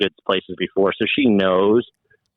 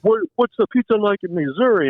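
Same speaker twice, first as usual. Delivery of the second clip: much higher, hammed-up Jersey pizza owner; Guido guy; 12 semitones higher than usual. Owner grills the Missouri visitor: high mock Guido voice. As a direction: play the brash Guido owner, incredulous. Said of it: "the ultimate Guido guy".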